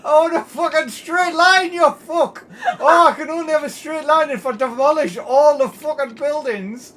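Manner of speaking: loud, high exasperated shouting; angry rant. Real, not acted. Streamer demanding a straight line underground, furious high shouting. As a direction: rage at the game at full volume. Ranting at bent track.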